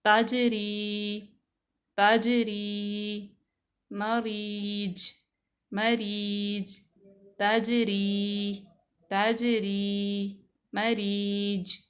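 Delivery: ताजरी and मरीज drawn out very slowly, the ज bounced; slow qalqala. Shown as a demonstration. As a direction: stretch each word and let the ज ring. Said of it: "जीम साकिना की मिसालें हैं".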